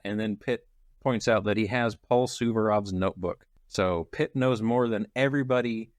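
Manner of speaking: ordinary talk, nothing marked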